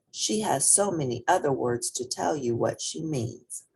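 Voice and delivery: plain reading voice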